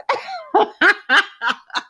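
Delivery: high, long burst of laughter; helpless cackling, tapering off; big belly laugh. Real, not acted. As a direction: crack up completely. Cracks up at own reaction.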